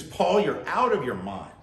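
a governor loudly cutting Paul off; loud interruption. Retold or imitated. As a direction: bark it at him like a governor interrupting. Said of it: "like, in a loud voice".

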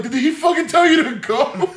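high, slow shout breaking into laughter; amused disbelief. Retold or imitated. Passenger asks driver about fugitive, in laughing disbelief. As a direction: call it out high, cracking up. Retold amazed question to driver.

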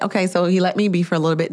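usual speaking voice

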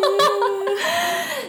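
loud, high-pitched laugh; real burst of laughter. Real, not acted. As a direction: crack up at your own confession. Laughs at her own awkward confession.

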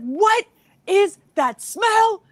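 high, loud, drawn-out astonished question; retold disgusted disbelief. Shopper recoiling at a smell in the store, appalled disbelief.

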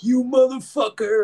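friend's private message, a high mock yell; mock outraged yell. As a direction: shriek it like a betrayed friend. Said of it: "they're just like".

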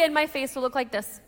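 ordinary delivery

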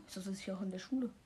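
soft unvoiced whisper. Real, not acted. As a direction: whisper it as an aside. Whispered aside. (flüsternd)

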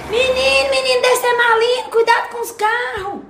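grandma calling across the street; calling out to a child. Acted. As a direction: call out to warn the grandson about cars.

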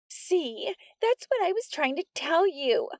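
put-on high tattling girl voice; high, eager snitch; whiny Polly. A narrator's reading.

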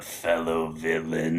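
low, slow evil villain greeting. Shown as a demonstration. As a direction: sneer it like a cartoon villain. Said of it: "you should be like".

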